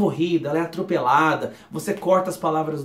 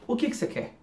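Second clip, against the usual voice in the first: rushed and slurred, words run together; fast slurred; 8 semitones higher, 4 dB quieter than usual. Shown as a demonstration. Teacher showing how Brazilians really say it. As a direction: gabble it like a seizure. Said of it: "parece que tá tendo uma epilepsia".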